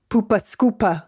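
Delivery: playful Northeast accent demo; mimicked Northeast English accent. Shown as a demonstration. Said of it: "if you wanted to mimic the accent".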